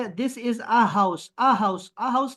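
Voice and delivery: "this is a house" drawn out very slowly; slow teaching read. Shown as a demonstration. Teacher modelling an English sentence slowly. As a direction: spell each word out for the child. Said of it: "یہ sign ہے کس چیز کا؟".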